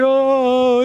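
high, drawn-out crowd "oh"; hammed-up sentimental coo; long "aww". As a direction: imitate a moved crowd melting. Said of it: "everybody's like".

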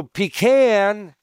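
"pecan" drawn out as pee-CAN; slow "pecan" with long pee and short-a CAN; pee-CAN pronunciation. Shown as a demonstration. Preacher announcing the poll winner, "pecan" as pee-CAN. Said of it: "so pecan wins".

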